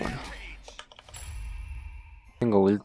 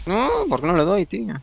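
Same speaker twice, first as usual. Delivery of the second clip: frustrated; 11 semitones higher, 3 dB louder than usual. Real, not acted. Missing shots, frustrated.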